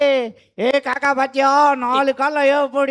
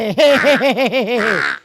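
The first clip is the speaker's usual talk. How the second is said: ஆட்டின் சந்தோஷ சிரிப்பு; gleeful laugh; 3 dB louder than usual. Acted. Goat jumping happily, freed.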